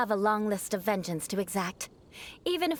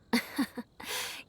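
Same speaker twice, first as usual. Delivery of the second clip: soft breathy scoff; 5 dB quieter than usual. Acted. Amused scoff at family insult.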